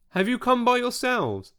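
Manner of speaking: put-on high-pitched, very English accent; crisply English-accented question, pitched high; decidedly English accent. A narrator's reading. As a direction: ask in a pointedly English accent. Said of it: "in a decidedly English accent".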